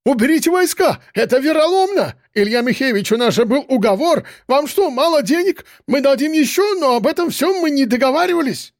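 high-pitched frantic pleading; hysterical protest. A narrator's reading. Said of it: "буквально бился в истерике".

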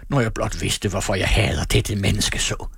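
ordinary delivery, nothing marked

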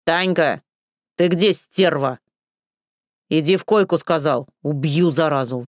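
put-on drunken yelling. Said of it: "раздался голос Александра".

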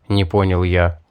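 ordinary delivery

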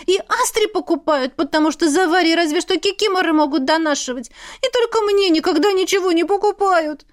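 high, aggrieved sibling tirade; pouting grievance. A narrator's reading. Younger sister envying the others' new clothes, pouting. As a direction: pour out a sulky, high-pitched complaint. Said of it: "надула губы сестра".

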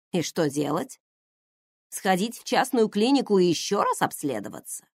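ordinary delivery

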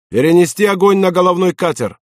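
high, sustained battle order; acted officer's command; shouted command. A narrator's reading. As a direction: call the new target over gunfire. Officer orders fire mid-battle.

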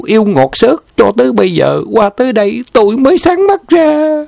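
furious wife screaming over a photo; jealous fury. A narrator's reading. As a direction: hurl the accusation like cannon fire. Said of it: "một loạt âm thanh cuồng nộ".

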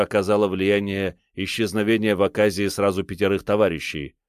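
ordinary delivery

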